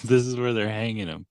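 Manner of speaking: ordinary talk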